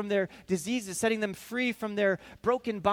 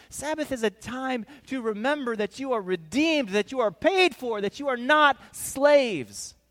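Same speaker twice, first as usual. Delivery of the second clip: building proclamation; 4 semitones higher, 8 dB louder than usual. Acted. Climax of Jesus' speech.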